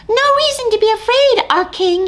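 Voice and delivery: tiny rescuer hurrying; eager mouse. Acted. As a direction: rush to reassure. Mouse voiced in story.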